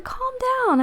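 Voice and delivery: high, soft soothing coo. Retold or imitated. Calming a raging man.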